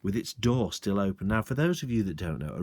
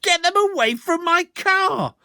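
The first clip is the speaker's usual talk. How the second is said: much higher, louder anguished cry; shouted; 7 dB louder than usual. Acted. Landowner shouting in anguish at the sergeant. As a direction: wail for the car. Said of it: "shouted Mister Hazel".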